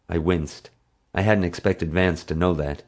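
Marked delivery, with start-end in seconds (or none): none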